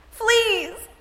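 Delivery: sudden high cry of alarm; startled shout; cried out. A narrator's reading. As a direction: yelp it in disgust. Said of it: "I cried".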